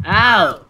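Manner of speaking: loud, high "ow"; short loud cry of pain; pained yelp. Real, not acted. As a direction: yelp as if just hit. Hurt in the game.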